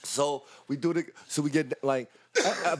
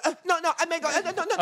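much higher coy no-no voice; Chris Kattan bit; faster than usual. Retold or imitated. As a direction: squeal the coy refusals way up high. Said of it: "Chris Kattan and S N L?".

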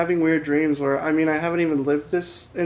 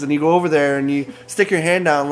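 ordinary delivery

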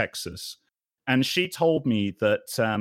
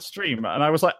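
ordinary delivery

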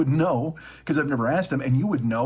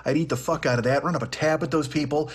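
ordinary talk

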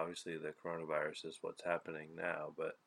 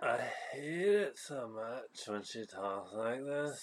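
slow, nasal, higher influencer drawl; annoying teenage-girl voice; 4 semitones higher, much slower than usual. Shown as a demonstration. Cohost shows off voice that irritates partner, annoying teenage-girl voice. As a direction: stretch the words in mock upspeak. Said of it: "tell the people how you say it".

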